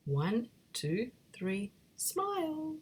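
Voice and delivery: slow count with a high sung-out smile; drawn-out photo countdown. Acted. Big Ted coaxing friends for the photo, slow and high.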